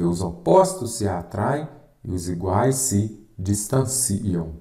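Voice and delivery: slow dictation with a break before "distanciam", stretched out. Shown as a demonstration. Teacher giving students a rule to write down, slow dictation. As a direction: dictate it in chunks, drawing out "distanciam". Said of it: "você vai escrever".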